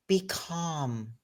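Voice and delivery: hushed and soft, drawing out each calm; soothing imitated acupuncturist. Acupuncturist hushing his tense patient, soft and soothing. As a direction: soothe her like a calm healer. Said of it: "he has very, you know, broken English".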